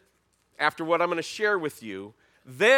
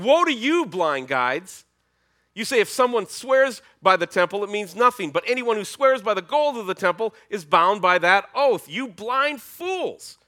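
loud, high preaching rebuke; Jesus raising his voice; 7 semitones higher, 7 dB louder than usual. Acted. Jesus rebuking the Pharisees before the crowd, loud. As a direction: hammer each charge home. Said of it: "he's gonna raise up his voice".

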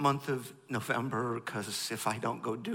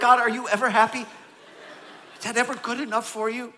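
exasperated complaint; 10 semitones higher, 11 dB louder than usual. Retold or imitated. Voicing the audience's complaint to God.

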